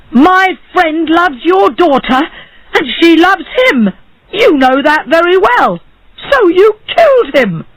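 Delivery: high, impassioned accusing voice; shouted accusation. A narrator's reading. Barber accusing the judge of killing his friend, shouting. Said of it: "shouted the barber".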